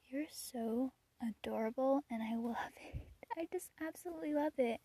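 soft whisper to herself; whispered aside. Retold or imitated. Watching the kids, she whispers her delight. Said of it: "And I'm like".

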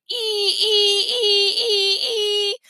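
put-on high, repeated toddler "ee" squeals; baby shrieking. A narrator's reading. Baby sister banging her spoon, shrieking. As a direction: squeal each ee like an excited baby. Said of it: "Tootsie shrieked, banging her spoon".

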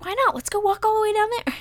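retold high, quick squeal of persuasion; high-pitched coaxing. Girlfriend nudging her boyfriend to walk further, high-pitched coaxing. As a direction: push the plea up into a squeaky high voice.

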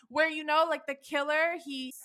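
ordinary talk

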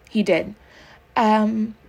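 plain reading voice

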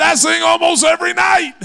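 high, loud shouted boast; father shouting to the doctor that he sings nightly; retold shout. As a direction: shout it out with pride. Dad answering doctor, story climax.

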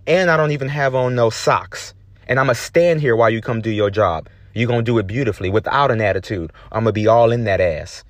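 usual speaking voice

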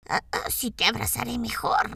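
lobo susurrando; susurro de lobo. A narrator's reading. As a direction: susurra como lobo taimado. Lobo responde. (lobo susurrante)

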